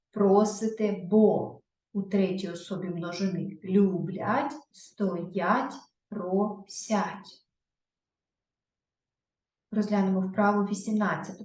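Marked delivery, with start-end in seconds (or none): none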